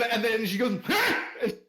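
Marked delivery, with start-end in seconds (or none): 0.9-1.2 very high, loud cut-off cry; sharp high yell of outrage; exasperated shriek. Acted. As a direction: shriek to shut someone up. Wife cuts off her husband.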